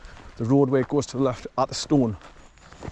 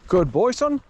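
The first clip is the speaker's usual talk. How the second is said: high, louder call with son rising; calling out to the dog; 8 semitones higher, 3 dB louder, slower than usual. Real, not acted. Owner calling to his dog far ahead, loud rising call. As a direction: call it across to the dog up the track.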